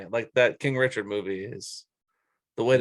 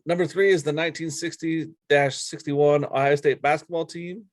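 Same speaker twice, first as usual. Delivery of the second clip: ordinary talk, nothing marked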